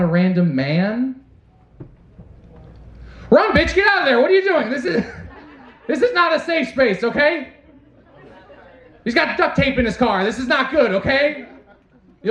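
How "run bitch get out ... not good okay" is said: run, bitch, get out in a high alarmed rush; pitched-up panicky plea; high excited warning. Acted. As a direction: yell a frantic warning to a woman in the woods. Urging woman to flee man.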